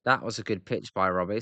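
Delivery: ordinary talk, nothing marked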